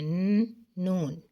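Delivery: drawn-out n sound before نون; slow sounding out. Shown as a demonstration. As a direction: stretch the ن before saying نون. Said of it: "یہ وہ الفاظ ہیں جو نون سے شروع ہوتے ہیں". (ن کو کھینچ کر آہستہ بولیں)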